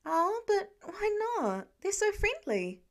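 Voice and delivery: put-on teen whining to dad for a pet; whiny begging. A narrator's reading. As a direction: stretch the plea out like a begging kid. Peter begging Tony for alpaca.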